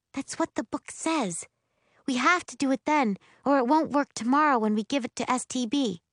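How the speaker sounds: put-on high-pitched earnest girl's voice; lightly acted bossy sister; insistent young schemer.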